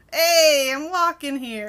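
loud, brash New York shout; hammed-up New Yorker calling out; New York accent. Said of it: "I apologize for our New York accents".

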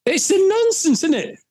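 high-pitched indignant outburst; exasperated. Real, not acted. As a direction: let the voice shoot up in disbelief. Indignant at the whole policy.

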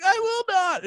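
very high-pitched determined squeal; mock defiant cry. Runner answering the hardcore breakdown, mock defiant high cry. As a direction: echo the singer in a hammed-up high voice.

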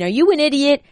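mock-bark the question like a grandma smacking her daughter; scolding grandmother. Grandmother from heaven scolding the mother, high mock outrage. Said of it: "and saying".

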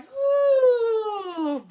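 high, loud mock groan of pain; a hammed-up wounded giant's howl; giant's pained cry. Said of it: "the giant was like".

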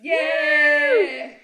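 ¡yeah! agudo y fuerte; grito de festejo. Real, not acted. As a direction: celebra gritando. Celebran el episodio diez. (grito eufórico)

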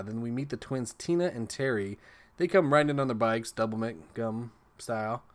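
ordinary talk, nothing marked

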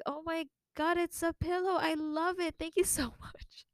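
high, over-sweet thanks; fake enthusiasm. Thanking the giver for a pillow, high and falsely sweet. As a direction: pretend to love it. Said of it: "I tried so hard to not be disappointed".

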